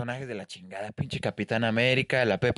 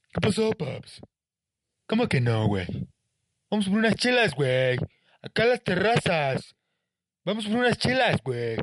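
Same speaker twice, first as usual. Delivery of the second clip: higher, easygoing dude voice inviting for beers; chilled-out dude; 5 semitones higher than usual. Retold or imitated. Dude inviting his friend out for beers, laid-back. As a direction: play the party buddy calling for chelas. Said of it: "desde el que está de".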